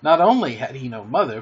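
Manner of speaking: ordinary delivery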